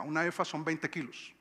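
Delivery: usual speaking voice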